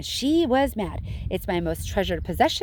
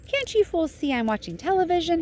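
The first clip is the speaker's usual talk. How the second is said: angrily; 8 semitones higher than usual. A narrator's reading. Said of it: "he said angrily".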